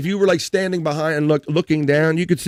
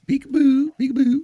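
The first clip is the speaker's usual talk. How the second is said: high, sing-song peekaboo; teasing peekaboo; 12 semitones higher than usual. Pretend. Teasing the screen image in a sing-song peekaboo. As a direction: coo peekaboo way up high like playing with a baby.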